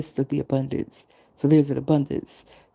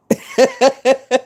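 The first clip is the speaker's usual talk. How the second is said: high, loud laugh bursting out; loud burst of laughter; 10 semitones higher, 8 dB louder than usual. Real, not acted. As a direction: crack up mid-story. Laughing at own retelling.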